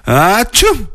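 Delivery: high, drawn-out fake sneeze; acted sneeze. A narrator's reading. Little sister catching cold after the mud, sneezing. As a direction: sneeze theatrically, rising high. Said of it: "éternua petite sœur".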